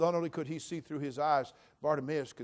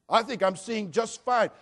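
mock self-assured protest; 8 semitones higher, 10 dB louder than usual. Mimics someone denying any blindness.

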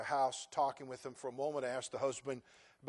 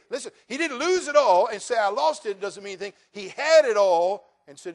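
higher, louder pulpit delivery with emphatic peaks; impassioned preaching; 6 semitones higher, 10 dB louder than usual. Real, not acted. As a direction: preach it with force, punching the key words. Preaches with rising force.

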